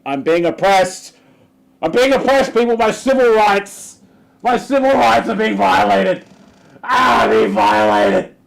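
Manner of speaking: high, loud wailing mockery of victimhood; hammed-up cry of oppression; mock oppressed whine. Mocking anti-mask complainers, high loud wailing parody. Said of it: "just turns out to be".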